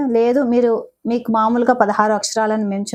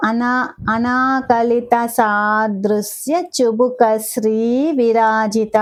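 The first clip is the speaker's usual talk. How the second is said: the whole name drawn out, ధర lengthened at the end; slow careful recitation; much slower than usual. Shown as a demonstration. Teacher modelling the full name for students, slow. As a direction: recite it slowly, stretching the last syllable. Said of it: "slow recitation demo".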